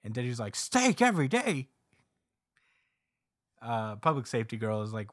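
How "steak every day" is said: much higher, louder, wide-eyed echo; amazed. Retold or imitated. Denji amazed at Sword Guy's steak promise, excited yelp. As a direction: gasp it out like the best news ever.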